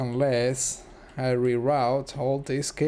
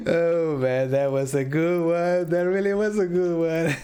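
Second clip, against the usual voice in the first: higher, louder, laughter in voice; amused, still laughing; 5 semitones higher, 5 dB louder than usual. Real, not acted. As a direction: recover from a laughing fit. Still amused after laughing fit.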